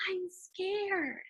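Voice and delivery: scared hush. Shown as a demonstration. Teacher repeating Huey's line, soft and scared. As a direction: say it small and frightened. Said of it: "I changed my voice when I said".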